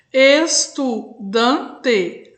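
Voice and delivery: "estudante" very slowly broken as es, tu, dan, te; split into four syllables. Shown as a demonstration. Teacher counts syllables for pupils, "estudante" split in four. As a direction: say "estudante" in four separate slow beats. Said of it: "eu emito o som de voz quatro vezes".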